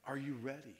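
normal talking voice

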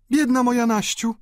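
high, loud woman's voice; high-pitched cry of sympathy; pitying sister. A narrator's reading. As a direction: exclaim with pity in a high voice. Delfina pitying her sister in the story.

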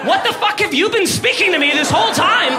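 shocked pet screaming in sudden understanding; indignant cat voice. Retold or imitated. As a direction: yell it like a cat who just realised it was lied to. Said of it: "as if to be like".

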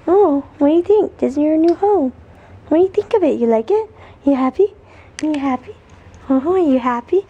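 high, loud sing-song cooing at the puppy; puppy talk. Real, not acted. Owner coos at her new puppy about its crate, high sing-song baby talk.

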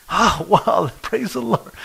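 high, laughing burst of joy; soaring delighted praise. Retold or imitated. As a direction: sound thrilled at the news. Joe hears joyful news.

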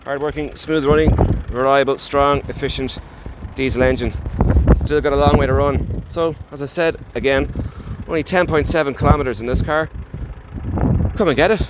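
shouting over wind. Real, not acted. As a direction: call out over the gusting wind. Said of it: "hopefully you can hear me over the wind".

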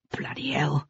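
soft oath under the breath; muttered swearing. A narrator's reading. Sebastian swears as pursuers close in, muttered softly. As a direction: swear quietly to yourself. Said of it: "swore Sebastian".

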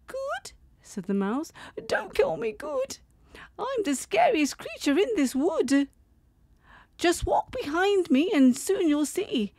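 very high, bold little mouse. Acted. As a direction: brave mouse boasting, squeaky high. Mouse voiced.

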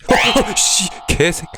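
high, shrieking jump-scare reaction; startled yelp. Real, not acted. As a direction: caught off guard and cursing in shock. (깜짝 놀라 욕하는 비명)

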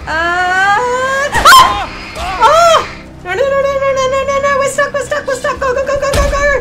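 very high, loud screamed repetitions; frantic panic. Real, not acted. Gamer stuck while fleeing enemies, screaming frantically. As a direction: shriek it in a rapid panicked stream.

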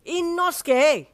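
long high shout into the wind; loud, stretched-out call. Acted. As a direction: bellow the name, drawn out. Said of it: "you shouted into the wind".

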